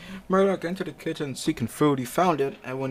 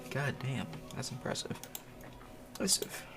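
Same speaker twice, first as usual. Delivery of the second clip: hushed awe; 13 dB quieter than usual. Real, not acted. Quiet awe at beer chugging.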